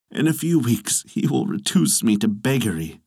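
usual speaking voice

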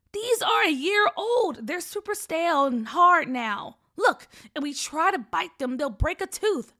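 loud, high start, settling slightly; emphatic protest. Acted. Brother warning sister off stale candy, emphatic protest. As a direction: argue loudly to stop the snatching.

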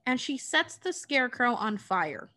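usual speaking voice

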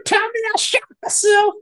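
high, frantic Cajun voice, losing it; panicked Cajun wail. Retold or imitated. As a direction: squeal it in a thick Cajun accent. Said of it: "losing it in his little Cajun accent".

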